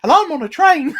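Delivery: high, loud mock Cockney breaking into laughter; Oscar Isaac's bad English accent. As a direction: ham up a squeaky fake London accent. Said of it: "such a bad English accent".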